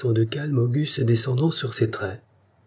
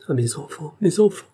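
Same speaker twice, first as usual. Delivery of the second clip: stammering in distress; 6 semitones higher than usual. A narrator's reading. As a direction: falter out the words in despair. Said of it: "balbutia-t-elle, d'une voix presque inintelligible". (balbutié, voix brisée de détresse)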